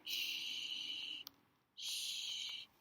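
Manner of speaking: soft breathy shush. Acted. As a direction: hush them gently. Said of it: "Gotta be super quiet".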